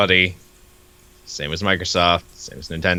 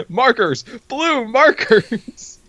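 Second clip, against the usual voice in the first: much higher, slow mocking echo of the word; silly high echo; 14 semitones higher, much slower than usual. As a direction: stretch it out in a squeaky mock voice.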